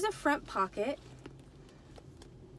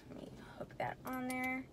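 whispered aside; 2 semitones higher, 7 dB quieter than usual. Real, not acted. Aside while fiddling with bag.

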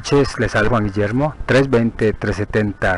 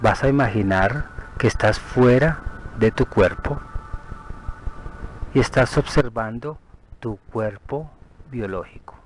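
guided meditation; 6 dB quieter than usual. Acted. Guide leading a listener to picture the body from outside, soothing meditation. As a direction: lead them gently through the visualization, unhurried.

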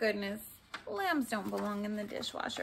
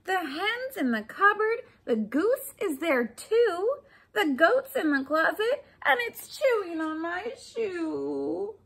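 a bit louder, rising exasperation; frazzled shopper; 6 semitones higher, 5 dB louder than usual. Acted. Woman listing where animals are, frazzled. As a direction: list it like chaos all round.

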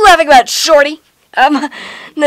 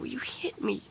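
whispering; 22 dB quieter than usual. Acted. Hurt after being hit.

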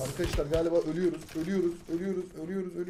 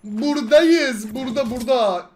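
hyped celebration; 5 semitones higher, 11 dB louder, slower than usual. Real, not acted. Celebrating clutch round.

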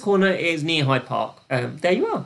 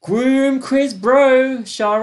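very slow, higher, louder name; drawn-out name call; 7 semitones higher, 6 dB louder, much slower than usual. Real, not acted. Host calling out a leaderboard name, drawn out. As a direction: stretch the name out like an announcer. Reading top player name.

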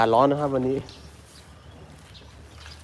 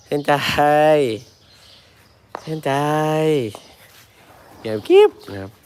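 gentle coaxing voice to an elephant; 3 semitones higher than usual. Shown as a demonstration. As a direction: coax the elephant kindly. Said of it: "ทำเสียงค่อย".

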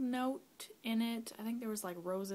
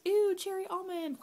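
high, loud squeal of ew; hammed-up, high-pitched grossed-out reaction; mock disgust; 12 semitones higher, 8 dB louder than usual. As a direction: recoil from a nasty smell, exaggerated. Acts out disgust at a smell.

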